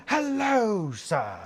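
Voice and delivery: high, booming HELLO dropping on sir; jump-scare bellow, imitating the bathroom attendant; startlingly loud greeting. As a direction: boom out a drawn-out greeting to startle someone. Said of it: "that's how loud he says it".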